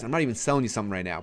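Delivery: usual speaking voice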